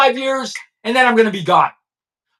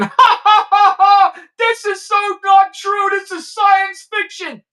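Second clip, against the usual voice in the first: high, scoffing fake laugh; hammed-up jeering laugh, then a slow dismissive sneer; mocking laughter; 9 semitones higher, slower than usual. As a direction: mimic the doubters laughing at him. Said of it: "people in there laughing".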